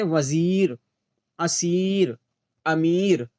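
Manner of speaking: वज़ीर, असीर, अमीर with long "ee"; word-by-word demo, "eer" held in वज़ीर, असीर, अमीर; long maroof vowel. Shown as a demonstration. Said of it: "खूब खींच कर पढ़ाई जाती है".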